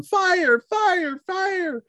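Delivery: high, repeated alarm cries; high mock yells, trailing away; imitated yelling. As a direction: mimic a camp in panic. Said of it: "running around the campground yelling".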